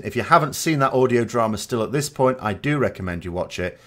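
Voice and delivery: ordinary talk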